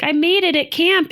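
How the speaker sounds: put-on high, proud little kid voice. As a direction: chirp it like a child. Voicing her kid.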